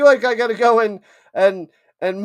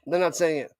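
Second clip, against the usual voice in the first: normal talking voice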